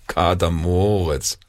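slow, offhand country mouse; nonchalant, drawn-out brush-off; casually dismissive. A narrator's reading. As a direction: draw the name out as no big deal. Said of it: "winkte die Landmaus lässig ab".

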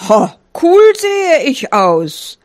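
high, slow, drawn-out swagger; cocky showing off. Acted. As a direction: preen with a long high "cool". Sheep shows off his T-shirt. (lässige Angeberei)

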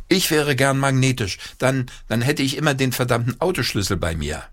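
father dreaming up a silly invention; playful father. Acted. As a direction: pitch it up, playfully wishful. Father joins inventing game.

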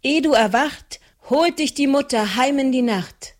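high, pressing chant-like warning; urgent incantation. A narrator's reading. Maren urges the sleeping Trude, high and pressing. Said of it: "Stärker und eindringlicher fuhr Maren fort".

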